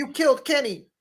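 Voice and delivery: high squeaky catchphrase; cartoon kid. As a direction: chirp it in a kid's voice. South Park catchphrase.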